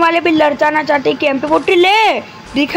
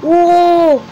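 surprised oh; 4 semitones higher, 5 dB louder than usual. Real, not acted. Sudden idea mid-game.

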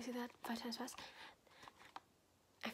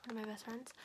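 ordinary talk, nothing marked

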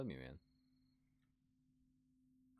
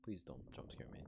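scared horror game streamer; 4 dB quieter than usual. Real, not acted. Horror game, fearing jump scare.

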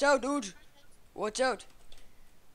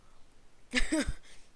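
breathy laugh; 5 semitones higher than usual. Real, not acted. Laughing during game.

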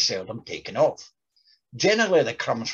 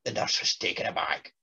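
usual speaking voice

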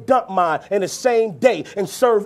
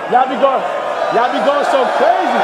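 raised voice over crowd noise; calling out; 3 semitones higher, 4 dB louder than usual. Real, not acted. Rapper to crowd going crazy, calling out. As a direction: call out higher and louder to a rowdy crowd.

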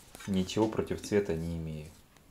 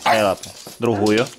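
firm loud command сидеть; firm dog commands; 6 dB louder than usual. Real, not acted. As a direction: bark out the order сидеть. Команды собаке. (твёрдая команда собаке)

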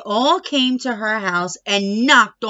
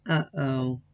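soft worried uh-oh; 11 semitones lower, 8 dB quieter than usual. A narrator's reading. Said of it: "said her mother".

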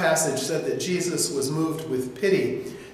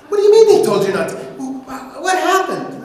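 high, loud and fast; exasperated outburst; 9 semitones higher, 8 dB louder than usual. Acted. Wife demands answers from her husband, exasperated and loud. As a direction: sputter it out in disbelief.

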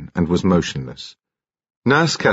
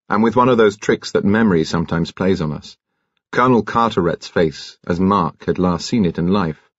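ordinary delivery, nothing marked

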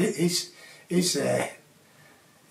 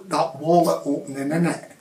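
ordinary talk

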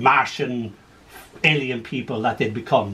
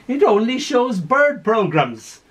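put-on high, far-off little old lady; a dotty gran delighted with her TV; 7 semitones higher, slower than usual. Confused old gran in care.

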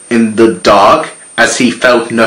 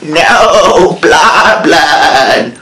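corrupt cop; 6 semitones higher, much slower than usual. A narrator's reading. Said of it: "the cop said".

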